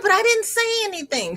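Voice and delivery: very high, whiny protest; protesting child. Retold or imitated. Child answering back to mother, high-pitched protest. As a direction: plead innocence in a high-pitched voice. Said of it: "I said".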